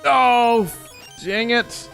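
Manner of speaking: high yelp of frustration; frustrated yelp. Real, not acted. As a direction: cry out as the item is lost. Failing the game.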